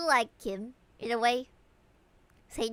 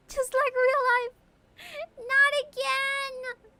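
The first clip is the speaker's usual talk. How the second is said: mock crying; 13 semitones higher, 4 dB louder, slower than usual.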